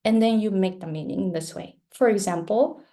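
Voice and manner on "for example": ordinary delivery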